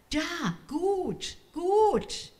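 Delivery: hohe, staunende Kinderstimme; staunend. A narrator's reading. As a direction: voller Staunen, deutlich höher. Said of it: "sagte sie staunend". (Mascha zeigt zur Musik, hoch und staunend)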